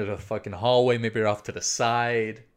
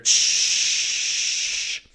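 imitated long aerosol spray; loud sustained "shhh" of a spray can; spray paint hiss; 4 dB louder than usual. Storyteller imitating spray painting on the door, long hiss. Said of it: "He's spray painting".

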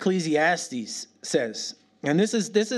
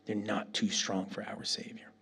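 whisper; 6 semitones lower, 10 dB quieter than usual. Shown as a demonstration. Preacher whispering to the congregation. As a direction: breathe it as a secret truth. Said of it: "repeated as whisper".

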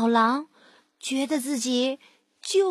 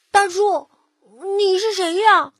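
higher, louder little piglet voice; a curious piglet calling out to a stranger; loud, high piglet asking the stranger; 6 semitones higher, 6 dB louder than usual. Acted. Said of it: "还是被小猪给看出来了".

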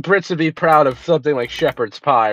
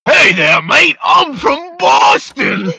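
put-on shouted, much higher, very slow Boston accent; 9 semitones higher, 4 dB louder, slower than usual. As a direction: yell it in an over-the-top Boston accent. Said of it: "I got a Boston accent".

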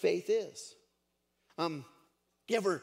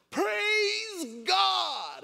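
long, high, ringing holler; high-pitched drawn-out shout of praise; loud praise shout; 7 dB louder than usual. Acted. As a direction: holler it out for the whole neighborhood. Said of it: "real loud so the whole community".

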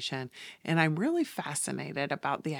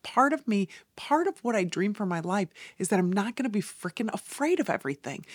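ordinary talk, nothing marked

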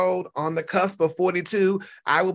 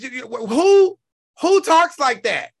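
higher, louder disbelieving shout; exasperated outburst; 6 semitones higher, 6 dB louder than usual. Real, not acted. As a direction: cry out in disbelief. Exasperated rant at the rival.

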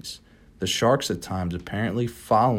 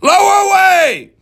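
cried; 13 dB louder than usual. Acted. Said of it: "cried Ahab".

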